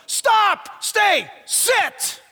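loud high commands to a dog; yelling commands. Retold or imitated. Preacher retelling yelling at his small dog, loud commands. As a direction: bark out stop, stay, sit. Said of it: "I yell to the little one".

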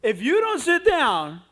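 slow, loud, high ultimatum; forceful mock scolding; threatening mother. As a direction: imitate a mom warning her child at full voice. Mother threatens boy, retold.

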